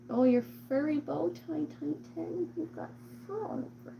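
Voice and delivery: cutesy coo trailing to soft; cooing pet talk. Real, not acted. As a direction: dote on a furry cat in a high voice. Cooing to furry cat.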